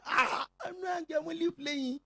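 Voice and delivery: high, loud playful protest; laughing shout. Acted. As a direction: shout it out, all in fun. Pastor reassuring begging member, shouting.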